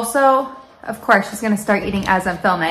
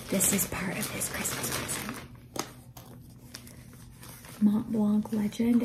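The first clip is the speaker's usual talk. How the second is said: hushed; 12 dB quieter than usual. Real, not acted. Husband nearby, hiding gift.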